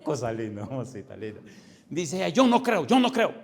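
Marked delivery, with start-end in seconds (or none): 0.0-1.4 low, soft cooing aside; fond teasing. Acted. Pastor's aside about the caller, fondly teasing. 2.3-3.3 imitated protest, high and louder; mock emphatic protest. Atheist caller protesting to the pastor, mock emphatic.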